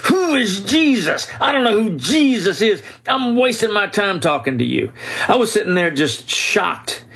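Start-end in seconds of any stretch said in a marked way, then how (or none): 0.0-4.9 high, strained mock scream at the top of his lungs; shrieking angry outburst, imitated; retold screaming. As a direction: act out a furious old man yelling, pitched way up. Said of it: "screaming at me at the top of his lungs".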